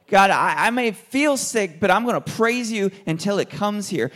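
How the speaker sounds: full-voiced praise that rises above the room; loud praise prayer. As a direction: cry out your thanks to God.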